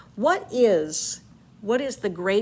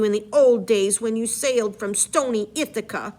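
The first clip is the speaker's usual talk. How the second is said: story narration, read aloud with energy; dramatic reading; 2 semitones higher, 5 dB louder than usual. Acted. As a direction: read the epic aloud to the class. Reads Circe's speech.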